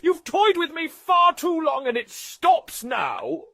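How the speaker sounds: slow, high, loud furious warning; shouted slow threat; angry ultimatum. Acted. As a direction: draw the line, enraged. Angry confrontation, final warning.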